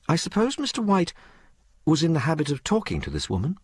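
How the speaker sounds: high-pitched, ladylike questioner; well-bred mistress Madge. Acted. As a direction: question the maid as her refined mistress. Story figure Madge.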